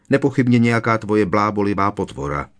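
ordinary delivery, nothing marked

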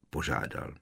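ordinary delivery

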